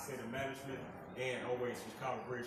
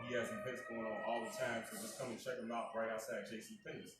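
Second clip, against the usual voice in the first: ordinary talk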